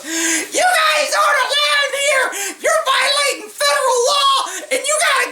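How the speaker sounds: mocking falsetto protest; hysterical girl. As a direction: shriek it like an outraged young woman. Girl screams at police.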